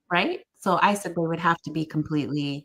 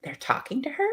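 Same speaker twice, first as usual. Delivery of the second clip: amazed; 8 semitones higher, 3 dB quieter than usual. Retold or imitated. Staff amazed.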